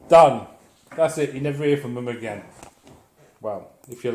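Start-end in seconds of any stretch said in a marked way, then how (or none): 0.1-0.5 louder final punch; emphatic done. Real, not acted. Closing the story, loud emphatic done. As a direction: slam the word down.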